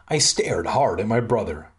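ordinary talk, nothing marked

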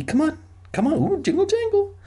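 put-on very high, cooing baby-talk voice. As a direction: coax a baby in a squeaky-high singsong. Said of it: "like you do to a baby".

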